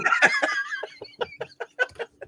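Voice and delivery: high squealing laugh; big high laugh. Real, not acted. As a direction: burst out laughing. Cohost laughs at age teasing.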